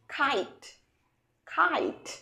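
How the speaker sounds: খাইট with a breathy kh; aspirated kh demo. Shown as a demonstration. Teacher contrasting খাইট with কাইট. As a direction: puff air after the k in খাইট. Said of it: "কাইট নয়".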